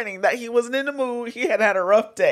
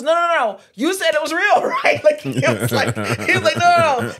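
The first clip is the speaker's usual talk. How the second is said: high and loud, laughing through the quote; laughing mock shout; 7 semitones higher, 7 dB louder than usual. Friend voicing the armed man's warning, laughing loudly. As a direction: shout it while cracking up. Retelling gunman's reply laughing.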